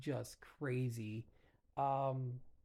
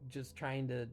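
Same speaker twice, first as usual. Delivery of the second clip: ordinary talk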